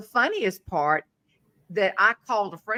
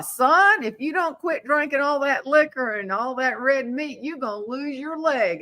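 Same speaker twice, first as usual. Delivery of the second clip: mock scolding; 6 semitones higher than usual. Acted scolding of Glenn.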